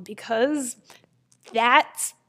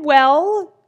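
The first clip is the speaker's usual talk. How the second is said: ordinary delivery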